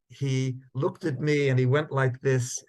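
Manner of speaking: ordinary talk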